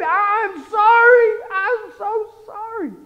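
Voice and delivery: loud, high, drawn-out mock sob; acted bawling. Preacher reenacting his breakdown before God, bawling apology. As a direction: wail the apology like a bawling baby. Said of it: "I'm bawling like a baby".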